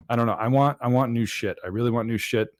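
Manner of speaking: usual speaking voice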